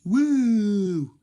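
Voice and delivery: high, loud mock whoop; teacher showing students a rude whoop, loud and high. Shown as a demonstration. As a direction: let out a rowdy whoop to show the bad behaviour. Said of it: "The people can hear you doing this".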